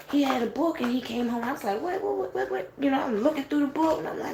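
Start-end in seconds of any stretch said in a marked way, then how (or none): 1.8-2.7 baffled what. Retold or imitated. Baffled reaction to the book.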